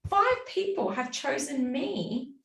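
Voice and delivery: high, giddy mock delight; delighted gush. Gushing about five people choosing her, in high delight. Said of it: "to being like".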